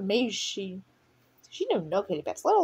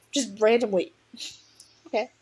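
plain reading voice

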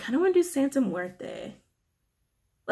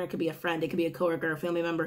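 normal talking voice

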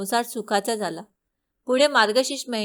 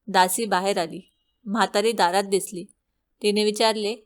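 usual speaking voice

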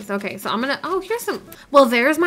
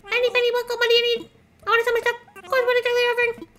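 a much higher goofy merchant voice, fast; silly shopkeeper bit; 12 semitones higher, much faster than usual. Pretend. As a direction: hawk your stuff in a cartoon voice. Goofing about selling stuff.

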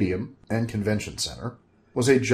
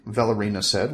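ordinary delivery, nothing marked

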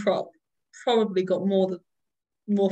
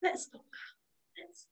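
whisper; 9 semitones higher, 12 dB quieter, much slower than usual. Retold or imitated. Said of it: "and you're like".

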